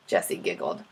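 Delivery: plain reading voice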